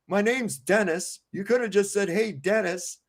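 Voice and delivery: lightly voiced prickly Dennis; indignant peasant. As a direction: peasant scolding the king, hammed-up huffy. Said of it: "he goes".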